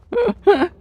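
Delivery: high, bright burst of laughter. Real, not acted. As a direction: laugh out loud. Laughs at trunk joke.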